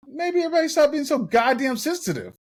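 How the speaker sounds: high, loud and aggressive; loud high outburst. Real, not acted. Snapping at chat for calling him loud, shouting. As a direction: blow up at the whole chat. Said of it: "It's so aggressive".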